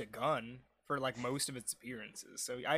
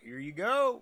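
mock proud citizen; 6 semitones higher, 7 dB louder than usual. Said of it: "and it's like".

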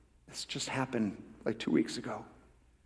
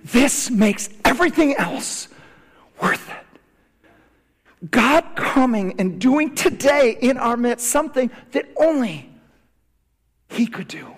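raised, high-pitched preacher's voice; impassioned preaching; 11 semitones higher, 7 dB louder than usual. Real, not acted. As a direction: lift the voice and preach with conviction.

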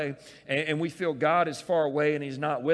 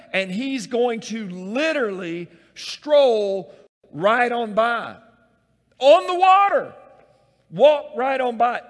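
loud, higher, slow proclaiming; preaching at full force; 8 semitones higher, 12 dB louder than usual. Real, not acted. Preacher to congregation, rousing loud proclamation. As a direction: drive the image home with a big shout. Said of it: "preacher builds to a shout".

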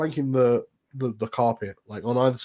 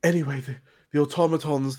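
normal talking voice

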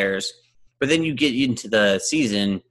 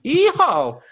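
much higher, loud Southern cowboy whoop; Southern yeehaw; 6 dB louder than usual. Acted. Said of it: "talk on a Southern accent".

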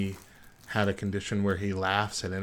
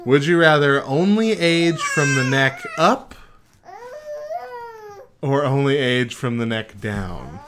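reading the question while fighting off laughter, slower; can barely get the words out for laughing; laughing through it; 4 semitones higher, 5 dB louder, slower than usual. Real, not acted.

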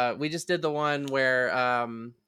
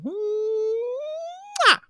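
kiss sound. Real, not acted. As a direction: blow a big kiss. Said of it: "get right up on this microphone".

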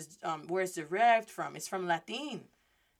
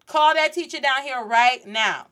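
loud, higher, no-nonsense demand; angry stepmama; 4 semitones higher, 10 dB louder than usual. Retold or imitated. Stepmama at the school office about education, angry demand. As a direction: order the teacher summoned at once. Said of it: "She showed up that next day".

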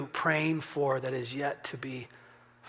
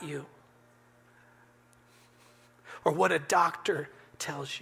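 higher, punchy preacher's delivery; rousing sermon voice, pitched up; forceful preaching; 5 semitones higher, 3 dB louder than usual. Acted. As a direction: build the exhortation with weight on key words.

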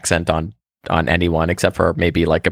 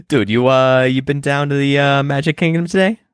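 higher-pitched Boston buddy; Boston accent; 5 semitones higher than usual. Acted. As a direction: chat up a pal in broad Bostonian. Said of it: "you do, you do that accent".

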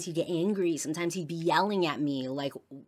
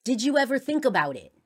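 retold heated accusation; 5 semitones higher, 7 dB louder than usual. Retold quote from a huge argument.

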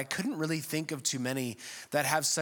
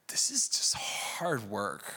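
whispered; 4 semitones lower, slower than usual. Real, not acted. Confessional aside.

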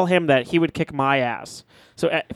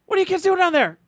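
high call from the principal down a hill; 13 semitones higher than usual. Retold or imitated. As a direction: call out high across the woods. Principal catches kids in woods.

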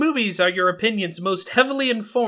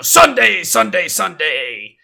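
booming first word, then slower and drawn out; monster truck announcer; 4 dB louder, slower than usual. Shown as a demonstration. Son shows where the joke voice came from, monster truck announcer. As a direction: hype the arena like a truck-rally announcer. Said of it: "It's like a monster truck announcer".

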